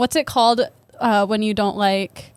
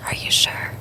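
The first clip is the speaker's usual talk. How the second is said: whispering. Pretend. Whisper teasing continues.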